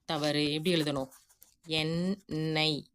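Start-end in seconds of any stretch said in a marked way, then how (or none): 1.7-2.8 எண்ணெய் slowly split as "en-nai"; syllable by syllable. Shown as a demonstration. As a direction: stretch out both halves of எண்ணெய். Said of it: "இந்த மாதிரி எழுதுறது தான் correct". (எண்ணெய் என்பதை அசை பிரித்து மெதுவாக)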